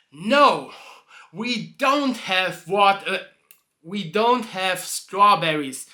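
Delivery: loud, slow, hard refusal; angry barkeep. Acted. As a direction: bite off every word in slow anger. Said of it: "So he's so angry".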